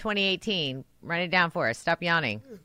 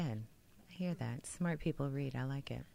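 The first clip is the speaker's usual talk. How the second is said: much softer whispered agreement; quiet approval; 5 semitones lower, 13 dB quieter than usual. Real, not acted. Boss murmuring approval of a reading goal, hushed. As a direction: murmur approval under your breath.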